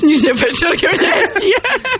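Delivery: giggling through the words; laughing. Real, not acted. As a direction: laugh while saying it.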